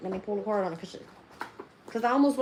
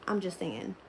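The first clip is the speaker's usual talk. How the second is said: normal talking voice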